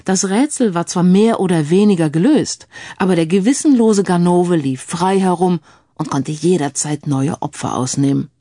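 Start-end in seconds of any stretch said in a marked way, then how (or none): none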